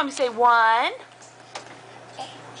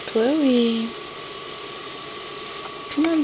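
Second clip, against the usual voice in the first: soft wake-up call; 2 semitones lower, 7 dB quieter than usual. Real, not acted. Parent waking child for church.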